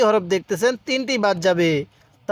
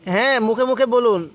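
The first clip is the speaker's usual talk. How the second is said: ordinary talk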